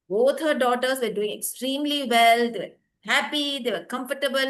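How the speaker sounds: ordinary delivery, nothing marked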